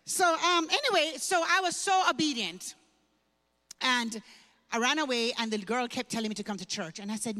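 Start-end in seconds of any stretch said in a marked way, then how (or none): none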